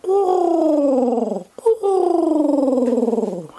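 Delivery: high, falling coo; playful pigeon coo for the child. Shown as a demonstration. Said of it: "I'll do the sound".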